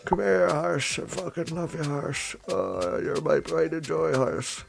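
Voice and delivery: put-on soft, high baby-talk cooing. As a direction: coo lovingly at a pet. Cooing love at the horse.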